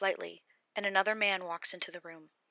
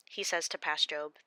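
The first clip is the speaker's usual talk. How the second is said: usual speaking voice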